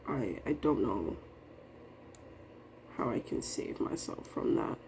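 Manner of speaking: low, fading into a whisper; hopeless whisper. Real, not acted. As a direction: let it slip out in a defeated whisper. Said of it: "I'm very sad".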